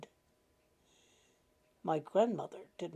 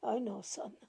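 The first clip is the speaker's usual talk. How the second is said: tired, softer whisper of resignation; 6 dB quieter than usual. Acted. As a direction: let it fade to a whisper. Grandmother repeats, fading to whisper.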